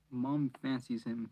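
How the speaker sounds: ordinary delivery, nothing marked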